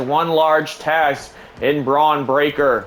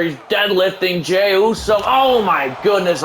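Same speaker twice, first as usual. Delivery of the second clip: higher, rising excitement as the move lands; excited call; 6 semitones higher than usual. Acted. Commentator calling the big deadlift to viewers, excited. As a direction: call it like you can't believe it.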